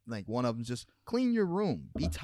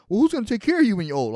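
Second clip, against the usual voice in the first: high, loud, fast mocking nag; 10 semitones higher, 11 dB louder, faster than usual. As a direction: rattle it off like a nagging busybody. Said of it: "people give me shit".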